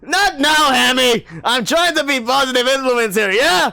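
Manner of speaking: high booming father; rowdy dad. Acted. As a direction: clap the boy on the back cheerfully.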